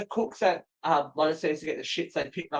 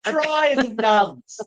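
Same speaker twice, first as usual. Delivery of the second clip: 1 drawn-out tease; 7 semitones higher, 4 dB louder, much slower than usual. Real, not acted. Joking jab at ghost school.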